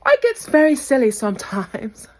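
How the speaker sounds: high cackling laugh; witchy laugh. Real, not acted. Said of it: "Did that sound like a witch?".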